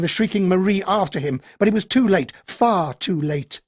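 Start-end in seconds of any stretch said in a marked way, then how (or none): none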